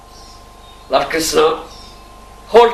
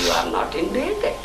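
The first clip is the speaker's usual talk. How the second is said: ordinary delivery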